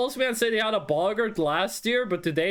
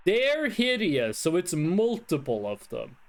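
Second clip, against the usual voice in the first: ordinary delivery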